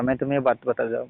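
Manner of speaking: ordinary talk, nothing marked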